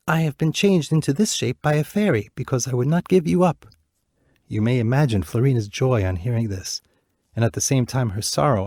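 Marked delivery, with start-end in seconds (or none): 0.1-3.6 a hint of a comforting prince, higher; lightly voiced prince. Bird prince comforting the weeping princess, lightly voiced. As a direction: reassure her gently. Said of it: "replied the bird".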